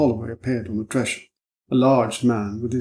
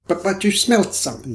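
reproachful; 7 semitones higher than usual. Acted. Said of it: "said Mister Middleton reproachfully".

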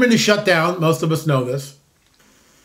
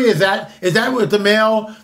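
ordinary talk

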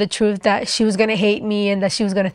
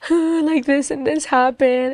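higher, a touch of remembered sobbing; crying confession; 6 semitones higher than usual. Retold or imitated. Telling the preaching lady what happened, lightly tearful. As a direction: blurt it out, mildly tearful. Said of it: "I just began crying".

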